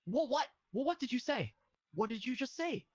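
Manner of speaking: put-on high squeaky panicked boy; freaked-out character voice. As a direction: squeak out confused protest.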